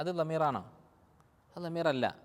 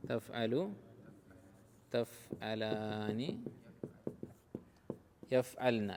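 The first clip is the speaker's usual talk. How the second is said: recitation; 5 semitones lower, 9 dB quieter, much slower than usual. Shown as a demonstration. Teacher chants verb conjugations.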